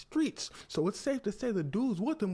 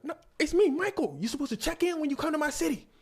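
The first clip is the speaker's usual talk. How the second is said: loud, high-pitched outburst; indignant shout; 14 semitones higher, 8 dB louder than usual. Acted. Michael confronting the star about checking in, indignant. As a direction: hurl it out fast, outraged at being unrecognised.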